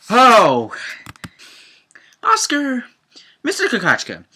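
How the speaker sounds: loud, high excited reaction to the episode; excited exclaiming. Real, not acted. Viewer exclaiming over the episode title, loud and high. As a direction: call out the title, pumped up.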